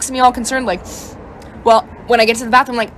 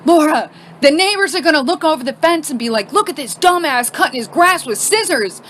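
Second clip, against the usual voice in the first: higher-pitched mock complaint; exasperated husband; 6 semitones higher than usual. Husband to wife while cutting grass with scissors, exasperated complaint.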